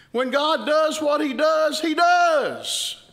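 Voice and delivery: rising to a loud, high preaching shout. Real, not acted. Preacher to his congregation on God's power, shouting. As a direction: proclaim it at full force, climbing in pitch.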